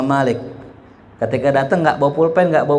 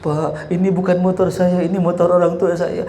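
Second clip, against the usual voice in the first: higher-pitched, imitated whimpering excuse; mock tearful plea; 4 semitones higher than usual. Youngster pleading with police over the motorbike, mock tearful. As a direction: plead like a tearful kid, half acted. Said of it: "nangis tuh".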